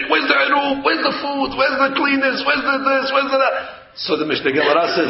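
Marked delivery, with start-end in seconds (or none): 0.1-3.5 high, nervous string of questions. Acted. Nervous husband coming home before Shabbat, nagging in a high voice. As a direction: play a nervous husband rattling off demands, pitched up. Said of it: "he's nervous".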